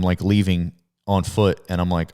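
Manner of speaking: ordinary talk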